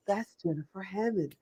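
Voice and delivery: soft, awed naming; hushed recognition. Acted. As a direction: drop right down as if realizing who it is. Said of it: "and you go".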